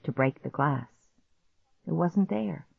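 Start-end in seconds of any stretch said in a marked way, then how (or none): none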